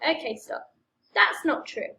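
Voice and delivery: plain reading voice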